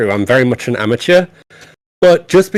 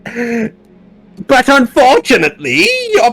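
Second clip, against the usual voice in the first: high slow villain, building to a shout; generic villain; 11 semitones higher, slower than usual. Shown as a demonstration. Demoing his generic villain voice to the co-host, slow high taunt rising to a shout. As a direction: taunt the hero, then rise to a cry. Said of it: "that generic villain sound".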